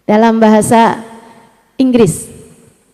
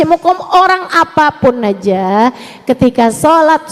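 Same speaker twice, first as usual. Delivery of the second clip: normal talking voice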